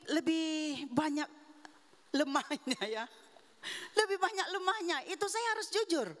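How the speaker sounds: ordinary delivery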